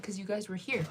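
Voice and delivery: ordinary talk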